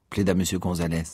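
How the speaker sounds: usual speaking voice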